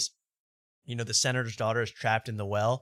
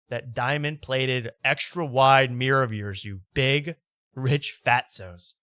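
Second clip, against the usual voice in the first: drawn-out mockery; slower than usual. Real, not acted. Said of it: "mocking the rich".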